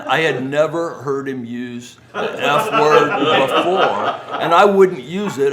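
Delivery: high, loud laughing speech; loud giggly explanation after the punchline; laughing while talking. Real, not acted. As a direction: talk through the laughter.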